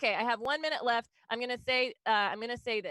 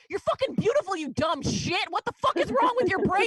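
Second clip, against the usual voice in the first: loud, high, mock-furious scolding; exasperated friend yelling; 9 semitones higher, 9 dB louder than usual. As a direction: yell an angry compliment like an outraged friend. Friends angrily insisting she is beautiful.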